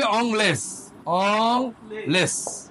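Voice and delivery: অংলেস drawn out very slowly, each sound held; very slow demo. Shown as a demonstration. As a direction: stretch "অংলেস" out syllable by syllable. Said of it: "তাহলে এটা কি হবে?". (খুব ধীরে টেনে টেনে "অংলেস")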